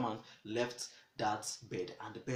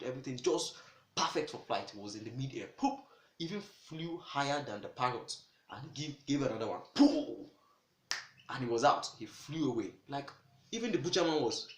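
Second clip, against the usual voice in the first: usual speaking voice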